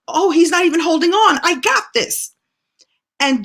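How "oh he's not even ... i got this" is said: high, loud burst of delight; thrilled child. Retold or imitated. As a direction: kid amazed at herself. Child realizes she rides alone.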